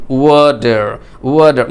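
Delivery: American "ওয়াটার" with d for t and a clear r; American accent. Shown as a demonstration. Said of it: "American অ্যাকসেন্টে যখন আপনি এই ওয়াটার". (American উচ্চারণে "ওয়াটার", ট-এর জায়গায় ড)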